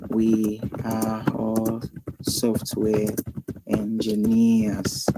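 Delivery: drawn out word by word while typing; slow, quiet typing-along read; slow dictation. Shown as a demonstration. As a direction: spell it out slowly as you type.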